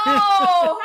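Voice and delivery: loud high excited cry with a laugh. Real, not acted. Reacting to the movie link, excited cry. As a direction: yelp out in delight.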